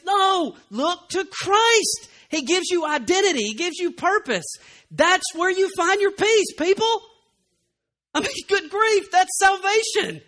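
high, loud, impassioned sermon; fiery preaching. Acted. Pastor urging the congregation to look to Christ, impassioned. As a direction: preach it to the rafters.

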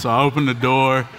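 ordinary talk

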